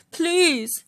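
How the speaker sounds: a mild beg from a trapped lion; begging Lion. Acted.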